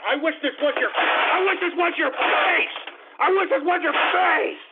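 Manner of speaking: high, straining angry yell; worked-up furious threat; enraged. Real, not acted. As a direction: rage as if pounding on something. Raging at prank callers.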